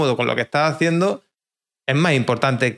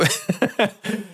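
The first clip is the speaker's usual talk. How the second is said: a quick high burst of laughter; short laugh; 6 semitones higher, 4 dB quieter than usual. Real, not acted. Laughs at own joke.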